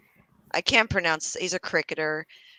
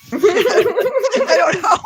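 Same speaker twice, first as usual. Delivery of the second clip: laughing; 9 dB louder, much slower than usual. Real, not acted. Laughing through awkward segue.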